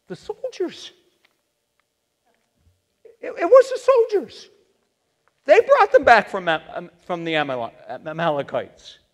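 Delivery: a cornered king blurting out blame; Saul making excuses. Acted. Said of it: "Saul answered".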